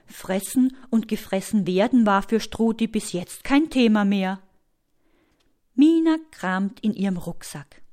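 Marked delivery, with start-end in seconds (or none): none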